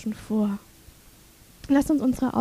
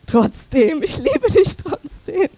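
tender self-talk; 4 dB louder, slower than usual. Shown as a demonstration. Preacher models tender words to say in the mirror, slow and loving. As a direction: say it tenderly to your reflection. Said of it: "dann sagst du".

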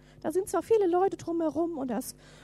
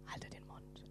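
a hushed, voiceless whisper; 20 dB quieter than usual. Acted. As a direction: hiss it like a crowd telling them to shut up. Crowd silencing the blind men.

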